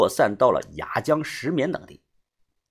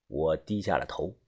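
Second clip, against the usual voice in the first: usual speaking voice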